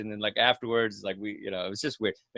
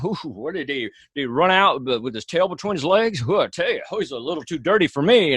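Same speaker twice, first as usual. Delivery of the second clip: [name] voice; 5 semitones higher than usual. Retold or imitated. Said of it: "in [name] voice".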